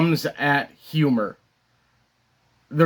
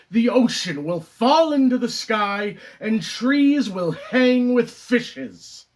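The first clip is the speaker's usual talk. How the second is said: theatrical Moon monologue; 4 semitones higher than usual. Acted. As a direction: let a brooding critic's speech build, pausing for effect. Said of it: "the character Moon".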